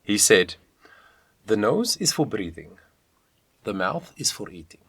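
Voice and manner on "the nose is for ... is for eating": measured, badly faked Swedish accent; amateur Swedish lilt, softly done; terrible Swedish accent. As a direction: quote the saying in a bad Swedish accent. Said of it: "That was a terrible Swedish accent".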